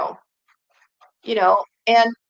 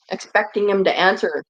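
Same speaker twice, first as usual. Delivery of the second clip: usual speaking voice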